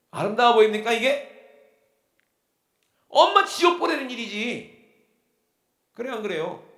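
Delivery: very loud, high-pitched preaching outburst; indignant rebuke. Real, not acted. Preacher challenging a member's excuse about mother, indignant shout.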